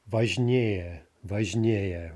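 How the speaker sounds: "важнее" slowly, stressed on the -ее ending; stress shifted to ending. Shown as a demonstration. Teacher forming a comparative, "важнее" slow with end stress. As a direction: draw out "важнее" with the weight on -нее. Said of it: "stress shifting to the ending".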